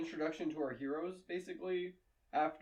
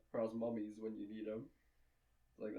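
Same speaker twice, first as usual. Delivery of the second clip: usual speaking voice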